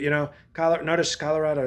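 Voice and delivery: usual speaking voice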